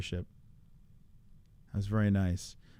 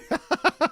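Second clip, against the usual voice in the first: burst of laughter; 5 dB louder than usual. Real, not acted. Streamer reacts to chat question.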